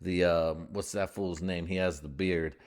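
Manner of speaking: normal talking voice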